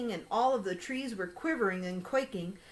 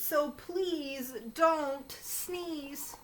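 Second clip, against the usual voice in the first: slow pleading; 4 semitones higher, much slower than usual. Acted. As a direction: draw out so please don't sneeze. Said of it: "slow pleading refrain".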